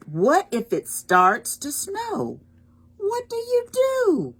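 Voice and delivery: high, loud storybook questions; dramatic wondering. Acted. Reader asking children the book's refrain, dramatic wondering. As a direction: raise the pitch with each question.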